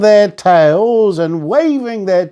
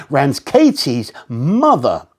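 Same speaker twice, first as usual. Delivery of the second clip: ordinary delivery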